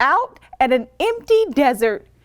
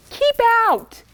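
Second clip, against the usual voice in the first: mild yell; 7 semitones higher than usual. A narrator's reading. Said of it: "yelled Hank".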